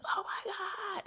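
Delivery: high, drawn-out squeal of surprise; stunned joy. Retold or imitated. Sister hearing she passed, slow high squeal. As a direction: stretch it out in amazed delight.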